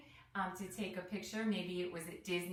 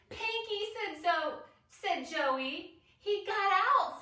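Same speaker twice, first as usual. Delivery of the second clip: high, loud alarmed cry; alarmed shout; 12 semitones higher, 7 dB louder, slower than usual. A narrator's reading. Children warning about the escaped flamingo, high loud alarmed cries. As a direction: shout it out, upset.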